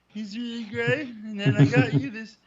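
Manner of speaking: high-pitched, sugary gift-giving voice; silly high voice. Pretend. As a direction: chirp it out sweet and eager. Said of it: "Why are we talking like that?".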